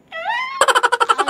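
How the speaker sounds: high, loud squealed "ah"; high-pitched retold scream; mock scream. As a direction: squeal it out, then laugh. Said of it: "sisigaw ako".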